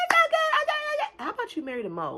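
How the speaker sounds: very high squeaky fake-nice voice; high mocking voice of the uncaring critters; mock fake praise. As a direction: squeak it out like a dismissive busybody.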